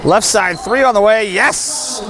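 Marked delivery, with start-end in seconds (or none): none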